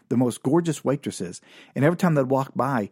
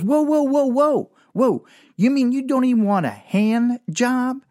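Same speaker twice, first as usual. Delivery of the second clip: high, loud mock hooker voice; the hooker stops him with a high, loud whoa; 10 semitones higher, 4 dB louder than usual. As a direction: squawk it out as the brash hooker.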